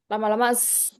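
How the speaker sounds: ordinary delivery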